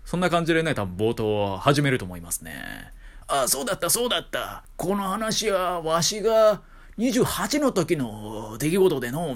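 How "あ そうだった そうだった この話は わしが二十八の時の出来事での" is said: put-on old man voice. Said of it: "みたいな". (おじいさん口調)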